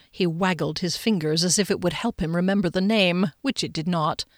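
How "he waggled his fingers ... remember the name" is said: plain reading voice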